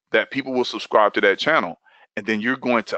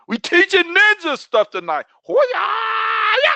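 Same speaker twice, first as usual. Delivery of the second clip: high-pitched hyped shout into a long yell; ninja battle cry; 3 dB louder than usual. Pretend. Coach hyping the class as ninjas, mock battle cry.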